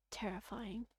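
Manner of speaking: whisper. Acted. Narrating horror in visual novel.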